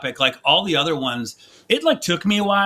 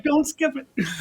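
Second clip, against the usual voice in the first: high pleading voice; 9 semitones higher, 3 dB quieter, slower than usual. Retold or imitated. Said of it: "I was like".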